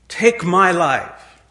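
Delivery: slow, loud and earnest, each word drawn out; pleading plea. Retold or imitated. Moses begging the Lord to take his life instead, slow earnest plea. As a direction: offer your own life, begging slowly. Moses offers himself for the people.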